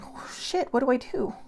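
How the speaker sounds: high, airy mock-panicked gasp; mock fear. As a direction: act out being frozen and at a loss. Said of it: "standing there in fear going".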